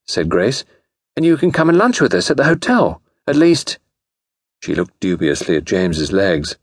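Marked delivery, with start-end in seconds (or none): 1.2-3.8 put-on high, airy society girl; high-pitched ladylike voice; young lady. A narrator's reading.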